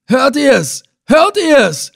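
high, loud angry bellow; furious roar. Acted. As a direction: roar it in fury. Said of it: "brüllte er wütend". (wütend brüllen, hoch und laut)

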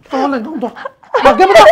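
father roaring an order in the quarrel; loud demand. Acted. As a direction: slam the demand at her. Father roars at daughter.

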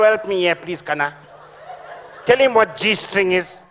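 ordinary delivery

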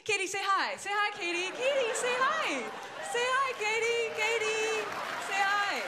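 very high, loud singsong squeal; mock coaxing mother. Caller pretending to coax her daughter to say hi, mock singsong. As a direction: parody a pestering mum, syllables stretched.

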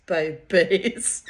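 loud rising babies breaking into a high laugh. Real, not acted. Teasing her friends about the bird of paradise, then laughing loudly.

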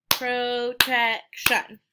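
"Protection" in three slow, separated beats; syllable by syllable. Shown as a demonstration. Teacher repeats for the syllable count, "Protection" slowly split up. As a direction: stretch "Protection" into pro, tec, shun. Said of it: "That has three syllables".

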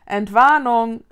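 loud all-clear call. Acted. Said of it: "rief Vicky".

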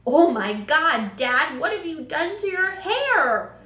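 loud son's cry, climbing high; appalled shout. A narrator's reading. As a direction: shout at Dad in disbelief. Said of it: "the son shouted".

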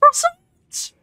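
high, surprised cry of a name; spotting her companion in the game; surprised call. Real, not acted. Gasps, calls companion.

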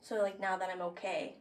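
soft, low, fighting tears; tearful confession. Real, not acted. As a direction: quietly open her heart. Emotional confession to sister.

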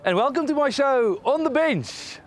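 high, bright presenter opening; show host intro. Acted. TV host opening his own show, upbeat presenter voice. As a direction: welcome the viewers like a TV host.

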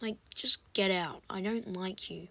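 soft, hushed grumble; muttered whisper. Real, not acted. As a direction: grumble under your breath. Muttering at enemy.

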